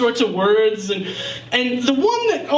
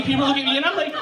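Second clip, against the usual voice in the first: ordinary talk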